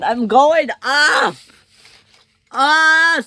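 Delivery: high drawn-out yell into a cry; screamed. A narrator's reading. Ferdinand as he is lifted, screamed. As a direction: keep yelling, high and loud. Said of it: "screamed Ferdinand".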